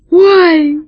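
high loud startled yelp; frightened cry. Acted. Rat captain startled when the lights go out, high frightened yelp. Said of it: "испуганный крик крыса".

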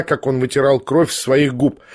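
ordinary delivery, nothing marked

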